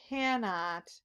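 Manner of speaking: ordinary delivery, nothing marked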